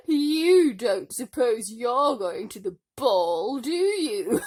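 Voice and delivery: loud, slow, scornful stepmother; sneering stepmother. A narrator's reading. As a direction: drag it out with contempt. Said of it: "said the stepmother".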